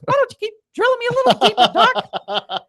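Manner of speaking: Mickey Mouse. Retold or imitated. Patient answers the doctor in a squeaky cartoon mouse voice. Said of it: "ask Mickey how he's doing".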